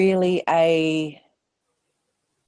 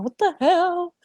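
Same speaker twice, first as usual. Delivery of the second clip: a panicked mind blurting out, much higher; startled inner voice; 12 semitones higher than usual. Retold or imitated. Her racing mind reacting to the recording, high startled. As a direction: pitch jumps high on a startled what the hell.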